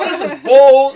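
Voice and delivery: loud, high shout of outrage; retold screaming. Viewer reliving his fury at the show, mock-furious yell. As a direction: bellow at the television, half laughing. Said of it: "I was screaming at my T V".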